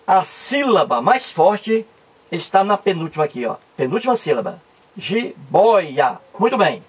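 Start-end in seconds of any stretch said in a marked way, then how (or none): 5.0-6.2 JIBOIA in three separate beats, ji, boi, a; measured syllable split of JIBOIA. Shown as a demonstration. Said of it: "Vamos fazer a separação silábica".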